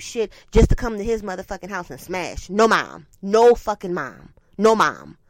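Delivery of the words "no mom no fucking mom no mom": Qatar salon imitation. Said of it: "they would always be like".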